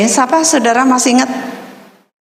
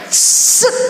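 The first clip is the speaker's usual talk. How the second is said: bullet whiz; 10 semitones higher than usual. Retold or imitated. Stray bullet flies past.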